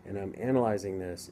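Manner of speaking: ordinary talk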